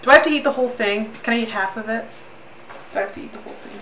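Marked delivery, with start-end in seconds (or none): 2.9-3.8 the question again, much softer and breathier; almost to herself, dreading it; hushed. Real, not acted. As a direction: dread muttered under the breath.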